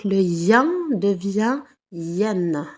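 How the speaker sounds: "yen" nasal, then "yen" with a clear final N; teacher contrasting the endings; slow sound demo. Shown as a demonstration. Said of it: "I E N, on double la consonne".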